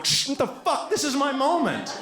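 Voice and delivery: high squeaky mock outrage; hammed-up wife voice shushing him; shushing high-pitched wife.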